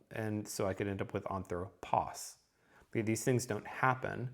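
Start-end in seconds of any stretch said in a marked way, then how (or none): 1.3-2.3 "anthropos" stressed on its last syllable, POS; final-syllable stress. Shown as a demonstration. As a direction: land the weight on the end of "anthropos". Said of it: "put it on the final syllable".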